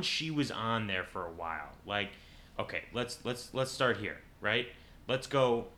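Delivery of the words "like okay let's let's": ordinary talk, nothing marked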